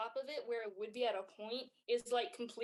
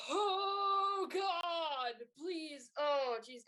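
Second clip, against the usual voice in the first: panicked plea; 5 semitones higher, much slower than usual. Acted. Wizard in the toad's mouth begs, panicky. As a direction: beg for help in a fluster. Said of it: "you just see him, like".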